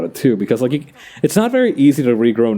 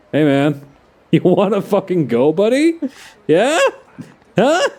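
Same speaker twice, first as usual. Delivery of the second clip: put-on belligerent drunk; 8 semitones higher than usual. Streamer taunts an enemy, voicing a thug.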